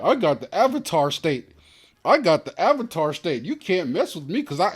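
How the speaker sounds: put-on high, loud bragging kid voice; mocking Aang. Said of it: "Aang used to always be like".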